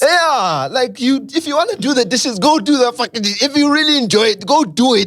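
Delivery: real exasperated shout. Real, not acted. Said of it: "Why are you shouting at us?".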